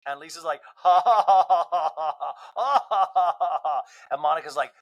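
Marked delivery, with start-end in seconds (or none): 0.8-3.8 forced, repeated ha-ha-ha, starting loud; loud mock laugh. Housewife answering an insult, loud exaggerated fake laughter. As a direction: fake a big drawn-out laugh.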